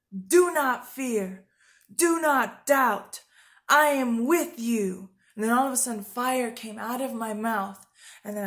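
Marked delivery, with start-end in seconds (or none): 0.1-5.1 louder, very slow, each command weighed; commanding voice of God. Retold or imitated. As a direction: speak as God commanding her firmly. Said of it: "And then he says".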